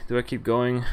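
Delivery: usual speaking voice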